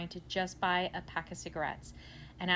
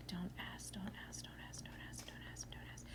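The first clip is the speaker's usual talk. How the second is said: unvoiced whisper, much softer; 15 dB quieter than usual. Muttering to himself beside the cashier, an unvoiced whisper. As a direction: hiss it to yourself, pleading.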